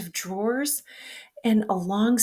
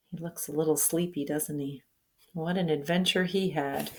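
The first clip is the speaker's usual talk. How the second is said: put-on reading to children; 4 semitones lower, 8 dB quieter than usual. Aside to child listener.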